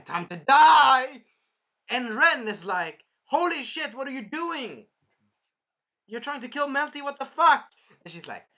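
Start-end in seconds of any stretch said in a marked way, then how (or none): 0.1-1.2 high, very loud, slow battle cry; villain's mock yell. Villain corners them on the mountain, mock villain yell. 3.2-7.7 high, loud acted protest; mock outraged shock. Ren protesting an attack on Melty, mock outrage.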